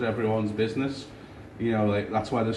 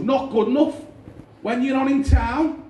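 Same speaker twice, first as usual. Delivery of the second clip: taxi driver; 12 semitones higher, 5 dB louder than usual. Retold or imitated. Taxi driver pressing the comedian for a gig date, loud high. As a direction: push him like a pushy fan. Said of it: "And he goes".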